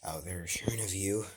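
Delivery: soft whispered aside. Acted. Cat whispering to itself after the fight, softly. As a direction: breathe it out in a whisper.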